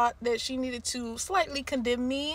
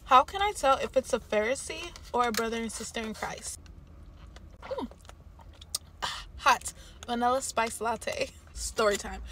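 talking between bites and sips; sipping through a straw; mouth full, chewing; 4 dB quieter than usual. Real, not acted. Seen 0: bites pastry and chews near 2.4-3.5s; sips through straw 7.2-8.3s.

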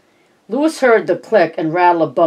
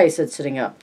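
plain reading voice

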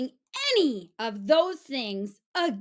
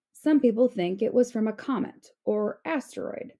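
usual speaking voice

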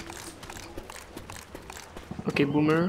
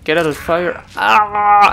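ordinary talk, nothing marked

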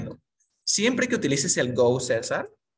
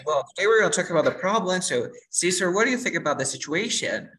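quick, higher English chatter that a beginner cannot follow; fast native English; 3 semitones higher than usual. Shown as a demonstration. Teacher speaks fast English to lost student, rushed and unclear. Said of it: "Te hablan muy rápido".

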